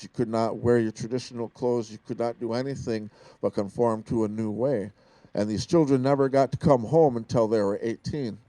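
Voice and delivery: ordinary talk, nothing marked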